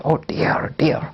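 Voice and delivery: breathy whispered oh dear dear; whispered dismay. Retold or imitated. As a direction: let it slip out as a defeated whisper. Defeated believer caught out.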